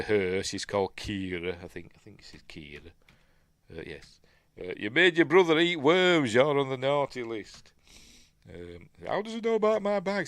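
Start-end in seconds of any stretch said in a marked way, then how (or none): 4.8-7.7 loud, high telling-off; mock accusation. Santa retold accusing someone. 9.1-10.3 high, quick incredulous question; mock disbelief. Retold character reacts in disbelief.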